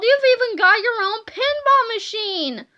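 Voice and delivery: high, loud excitement; amazed cry. A narrator's reading. Boy finds a pinball machine, crying out excitedly. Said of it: "cried Harold".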